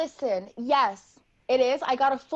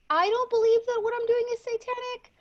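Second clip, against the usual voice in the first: indignant denial; 11 semitones higher, 4 dB louder, faster than usual. Acted. Offended girl defends herself.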